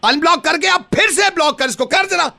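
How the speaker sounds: a high, excited push to block him again; excited urging. Real, not acted. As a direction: egg her on with glee. Friend eggs her on loudly.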